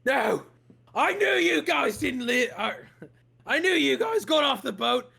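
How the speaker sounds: high, loud furious shouting; angry guard. Acted. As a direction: accuse them with rage. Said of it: "he gets incredibly angry".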